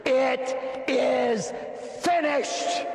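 "It is finished" pitched high and ringing; triumphant declaration. Acted. Jesus to Satan, high triumphant declaration. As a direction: announce the victory up high. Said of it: "he says".

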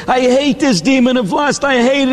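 acted high-pitched desperate outcry; crying out in frustration. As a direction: cry out at your wits' end, pitched high. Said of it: "he keeps crying out to God".

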